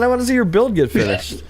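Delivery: high, excited repeat; upbeat repeat. Real, not acted. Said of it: "I said".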